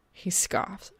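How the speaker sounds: usual speaking voice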